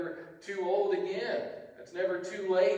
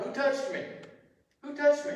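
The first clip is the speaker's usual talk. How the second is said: calling out; 10 semitones higher, 6 dB louder than usual. A narrator's reading. Jesus calls out in crowd.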